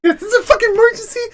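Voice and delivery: high, fast, frantic cry; panicked exclamation. Retold or imitated. Son insisting to mom during the smoke, frantic high cry. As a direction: rush it out in a high-pitched panic.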